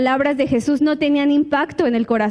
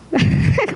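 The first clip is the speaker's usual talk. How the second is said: risa aguda; 5 semitones higher than usual. Real, not acted. Risa tras la pregunta.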